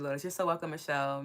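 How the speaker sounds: usual speaking voice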